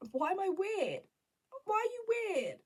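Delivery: high, loud squealing self-mockery. Vlogger mocking herself for being weird, loud high squeal. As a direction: shriek the question at yourself.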